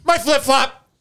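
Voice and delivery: high, loud panicked whine; imitating Corey. Corey losing his flip-flop in the dark, high loud whine. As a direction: wail about the lost shoe. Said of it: "Corey answers".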